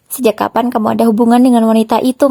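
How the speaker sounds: ordinary delivery, nothing marked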